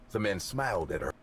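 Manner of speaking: ordinary delivery, nothing marked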